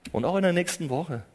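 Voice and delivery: ordinary talk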